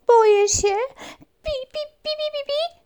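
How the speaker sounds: very high frightened squeaking; scared squeak. Acted. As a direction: squeal in fear like a mouse. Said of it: "pisnęła myszka".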